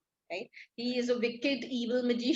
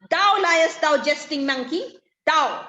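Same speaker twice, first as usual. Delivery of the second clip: loud, higher-pitched acted retort; annoyed Caliban; 4 semitones higher, 7 dB louder than usual. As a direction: throw the accusation back loudly, annoyed. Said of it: "So Caliban is annoyed".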